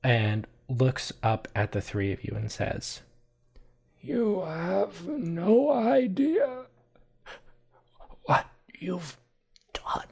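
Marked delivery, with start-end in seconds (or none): 4.0-10.0 put-on dying old man's last words, very slow; strained high rasp fading to a breathless whisper; wheezing, gasping for air. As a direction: gasp it out with long breaks, voice giving out. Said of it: "wheezing and gasping for air".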